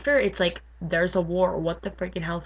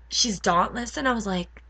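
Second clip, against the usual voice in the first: normal talking voice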